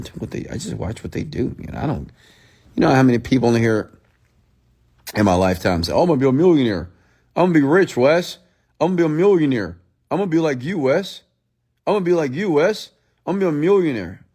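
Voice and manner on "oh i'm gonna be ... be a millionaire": higher-pitched mock boasting; mocking imitation. Streamer imitating followers who promise him they'll succeed, mocking. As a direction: parrot empty boasts in a repetitive singsong.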